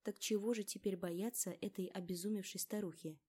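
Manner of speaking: ordinary delivery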